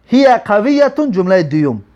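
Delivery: usual speaking voice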